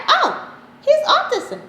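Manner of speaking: high, loud, drawn-out retold verdict; retold exclamation. Doctor announces the son's diagnosis to parents, high exclamation. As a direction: call it out high, as a sudden discovery.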